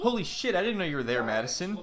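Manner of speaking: softly startled, pitch starting high and falling; hushed mock shock, trailing down. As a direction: imitate being caught talking to yourself. Mimics Joe startled by Madison.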